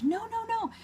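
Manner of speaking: very high, panicked no's in a chorus; squealing protest. Retold or imitated. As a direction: squeal them out like a crowd. Volunteers join the protest.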